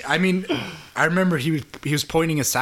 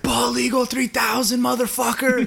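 high pumped-up yell; yelling mid-plank; 10 semitones higher than usual. Retold or imitated. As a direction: bellow it like you're straining through a plank. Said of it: "he was fucking yelling".

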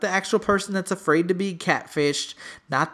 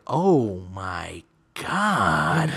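low, very slow, mock-tearful oh my God; mock shock; 9 semitones lower, much slower than usual. Nev reacting to the mom's confession, mock-tearful. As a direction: drag it out, fake weeping.

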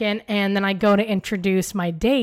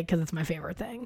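whispered aside; 6 semitones lower, 11 dB quieter than usual. Real, not acted. Confessing aside about favorite word.

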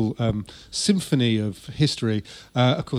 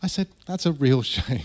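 mock airy reply; 5 dB quieter than usual. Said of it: "says Lutyens".

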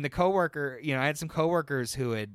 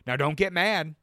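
imitating a coworker; 5 semitones higher, 6 dB louder than usual. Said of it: "someone coming up and just being like".

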